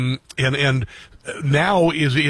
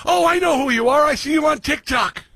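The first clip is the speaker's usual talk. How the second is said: excited fan; 12 semitones higher than usual. Retold or imitated. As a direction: play the starstruck driver recognizing him. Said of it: "they go".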